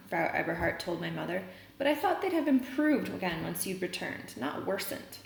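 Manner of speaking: reading a novel aloud to the class; story reading. A narrator's reading. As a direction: read the chapter out steadily for students.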